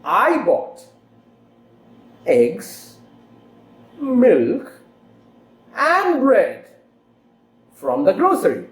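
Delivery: EGGS and MILK each rise, BREAD high then falling; rising list intonation. Shown as a demonstration. Teacher demonstrates a shopping list, rising list intonation. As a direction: pause between items, lift "eggs", "milk", high "and bread". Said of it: "use rising intonation. I'll give you an example".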